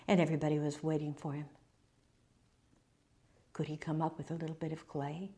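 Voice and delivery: quick, low and very soft; hushed suspense. A narrator's reading. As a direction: narrate under your breath while all wait. Narrating the hushed wait.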